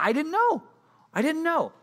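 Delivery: very high, small helpless voice; mock sheepish whine. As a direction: play the caught-out kid. Speaker's sheepish reply to scolding.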